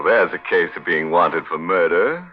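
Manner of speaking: ordinary talk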